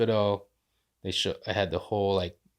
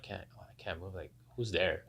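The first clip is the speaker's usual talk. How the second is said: weak and quiet; 3 semitones lower, 9 dB quieter than usual. Retold or imitated. Paralyzed, calling to friends.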